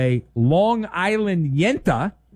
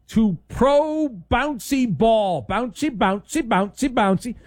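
slow, higher sing-song bouncy; playful segment intro; 7 semitones higher than usual. Acted. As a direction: bounce the words like a ball.

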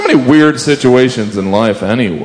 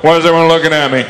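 self-conscious cop; 6 semitones higher, faster than usual. Retold or imitated. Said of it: "that look like".

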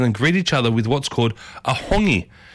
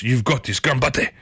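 normal talking voice

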